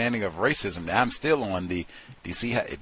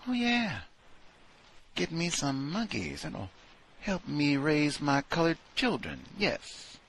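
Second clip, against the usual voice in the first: mock coworker; 7 semitones higher, 5 dB quieter than usual. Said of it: "to then come back and".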